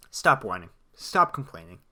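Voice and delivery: low, firm telling-off; a deep, blunt reprimand aimed at a whining coach; stern scolding. Acted. Scolding Sean Payton directly.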